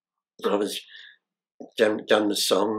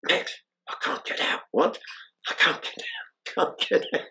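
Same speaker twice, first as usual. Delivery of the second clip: hushed voiceless plea, softer; muffled from the sack; 6 semitones higher, 4 dB quieter than usual. Retold or imitated. Escape artist stuck in the sack pleading to Max, hushed and muffled. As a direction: whisper it as if trapped inside a sack. Trapped inside a tied sack.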